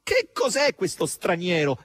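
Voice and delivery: high, drawn-out cry of dismay; dismayed exclamation. A narrator's reading. Margherita exclaims about the stranger, dismayed. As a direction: exclaim it, aghast. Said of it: "esclamò nel suo sgomento Margherita".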